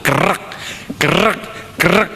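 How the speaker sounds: high, rasping squeeze sounds, spaced out; imitated crunching noise. Preacher mimics each two-handed handshake, crunching sound effect. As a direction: mimic a sore hand getting crushed.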